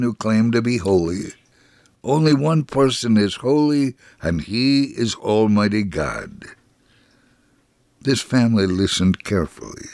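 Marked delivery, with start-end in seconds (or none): none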